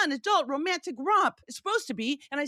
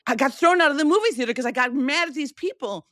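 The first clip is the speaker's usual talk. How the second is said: upset outburst; 5 semitones higher, 6 dB louder than usual. Retold or imitated. Telling husband after theater.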